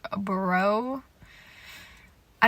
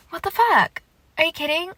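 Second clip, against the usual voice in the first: high, loud disbelief; stunned outburst; 7 semitones higher, 4 dB louder than usual. Real, not acted. Fan reeling from a scene, high loud outburst. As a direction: blurt it out in shock.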